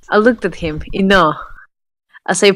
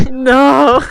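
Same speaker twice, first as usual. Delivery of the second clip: hammed-up sob on a louder no; fake-cry the word like a sulking kid; mock sobbing; 5 semitones higher, 7 dB louder than usual. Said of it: "He's, like, sobbing".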